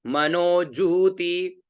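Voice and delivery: मनोज्योति with a long जू and a short ती, said slowly; teacher spelling out मनोज्योति slowly; slow careful pronunciation. Shown as a demonstration. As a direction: stretch जू in मनोज्योति and keep ती short. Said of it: "तो उच्चारण क्या हुआ?".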